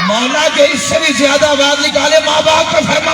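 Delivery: loud, rising prayer chant over music; building prayer call. Acted. Preacher's escalating prayer call to the gathering, rising to a shout.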